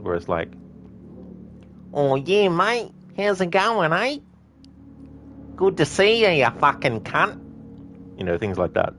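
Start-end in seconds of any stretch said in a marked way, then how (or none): 1.9-7.4 broad, hammed-up Australian accent, pitched high; exaggerated Australian accent. Shown as a demonstration. Demoing his exaggerated Australian accent to listeners. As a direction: overplay the Australian twang. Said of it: "that's how I do the very, um, exaggerated, um, Australian accent".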